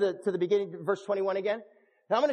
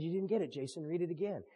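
soft, low voice of patient correction; quiet insistence; 5 semitones lower, 10 dB quieter than usual. Retold or imitated. Holy Spirit tells the preacher to read again, quiet insistence. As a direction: drop to a hushed, low repeat.

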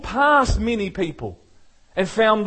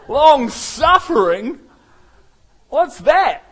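slow, loud, higher disbelief; a hammed-up outraged long suffering; mock shock; 6 semitones higher, 5 dB louder, slower than usual. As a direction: play the startled spouse.

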